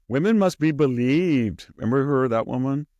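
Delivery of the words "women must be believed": higher, louder mock slogan; mocking Hirono. Mocking Senator Hirono's hearing slogan, loud and high. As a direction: proclaim it like a shrill rally cry. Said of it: "Senator Hirono?".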